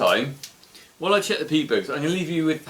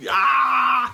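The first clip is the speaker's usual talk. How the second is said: startled cry; 10 semitones higher, 4 dB louder than usual. Real, not acted. Sudden shock during tasting.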